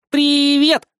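put-on high excited child greeting; chirpy little girl. Little girl's voice.

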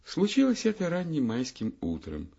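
ordinary delivery, nothing marked

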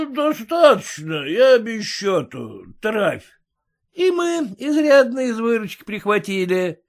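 loud, high tipsy bluster; drunk merchant. A narrator's reading.

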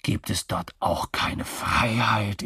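whispered, confidential question; drunken whisper. A narrator's reading. Drunk moustached man whispers his question to the woman. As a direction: lean in and whisper it.

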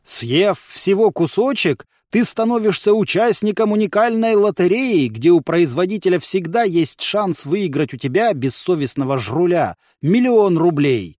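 upbeat commercial voiceover; ad announcer voice. A narrator's reading. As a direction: sell it like a TV ad. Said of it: "бодрым голосом рекламного диктора".

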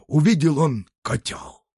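plain reading voice

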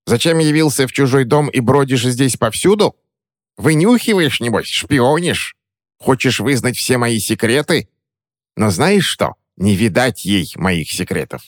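high sneering hag voice; suspicious crone. Acted. Witch accuses the boy of spying, high and sneering. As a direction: paranoid old witch interrogating.

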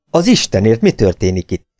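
enyhén riadt felkiáltás; kérdezd kissé megriadva; riadt kérdés. A narrator's reading. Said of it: "kérdezte riadtan". (visszafogottan riadtan)

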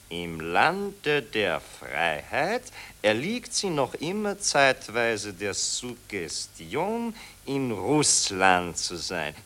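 slow, low, solemn dictation; a reporter slowly dictates the fabricated article; dictating a story. Acted.

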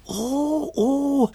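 tiny high-pitched uh-oh; tiny creature voice. A narrator's reading. Smallest Gigglerin reacting to Jimmy, tiny high voice.

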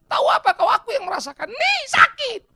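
high, loud mock outrage; angry. Know-it-all patient scolding the doctor, angry. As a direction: yell it as an indignant patient. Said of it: "Marah dia".

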